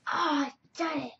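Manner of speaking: ordinary talk, nothing marked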